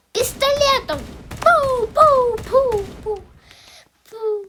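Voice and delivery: high, loud shooting noises; finger gun sounds. Real, not acted. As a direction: fire off playful pew pews. Імітує постріли. (звуки пострілів)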